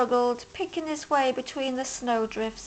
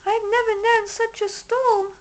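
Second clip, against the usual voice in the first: high, squeaky mother mouse voice; 10 semitones higher, 4 dB louder than usual. A narrator's reading. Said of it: "squeaked Mother Mouse".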